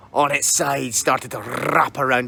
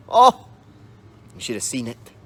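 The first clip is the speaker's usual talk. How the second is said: ordinary delivery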